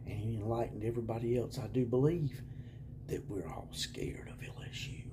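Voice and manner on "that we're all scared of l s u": sarcastic scared whisper; mock whisper.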